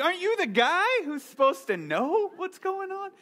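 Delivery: high, loud mock outrage at the pilot; incredulous. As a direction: squeak it out in disbelief. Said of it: "I'm like".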